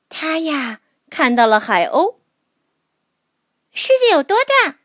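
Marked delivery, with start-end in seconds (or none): 3.7-4.8 put-on high, curious child voice; little mole voice. A narrator's reading. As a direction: ask like a small wide-eyed creature. Mole asks the seagull.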